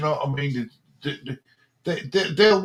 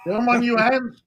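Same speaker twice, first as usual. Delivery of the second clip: put-on the host's line repeated in a higher American accent; 6 semitones higher, 4 dB louder than usual. Co-host repeats the host's line, in an American accent. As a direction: echo it back American style. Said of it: "give me your best American accent".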